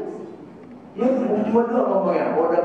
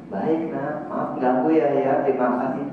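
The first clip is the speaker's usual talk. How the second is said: gentle fatherly reply; 5 semitones lower than usual. Retold or imitated. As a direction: answer your child tenderly. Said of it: "dibalas saya". (ayah menjawab anak dengan lembut)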